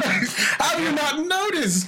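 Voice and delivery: put-on drawn-out, incredulous posh British accent; mock posh British accent. Asks friends how they missed it, in a mock posh British accent. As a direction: put on a stuffy British gent, slowly. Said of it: "I've talked like this my whole".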